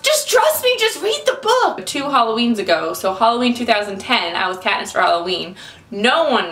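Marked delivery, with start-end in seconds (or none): 0.0-1.9 high, pleading insistence; insistent plea. Retold or imitated. She urges doubtful friends to read it, high and pleading. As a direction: beg them to trust you. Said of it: "And I'm like".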